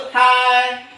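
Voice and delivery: "Hai" called high and loud; called cue. Shown as a demonstration. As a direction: call "Hai" out to group one. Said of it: "Kelompok satu". (panggilan "Hai" yang tinggi dan keras)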